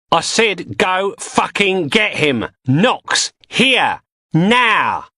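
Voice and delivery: high, slow, furious command; angry shouted orders. Acted. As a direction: bark the order and make them jump. Gang boss shouting orders.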